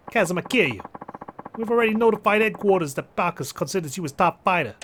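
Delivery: put-on Jersey accent. Mafia man recruiting a top fighter, Jersey accent. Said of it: "Japanese mafia member has a Jersey accent".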